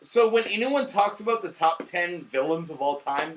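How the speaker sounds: normal talking voice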